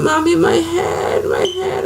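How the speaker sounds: put-on high, wailing child crying out; high pained child's wail. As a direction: imitate a sick four-year-old crying for his mom. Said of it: "my four-year-old is screaming and crying".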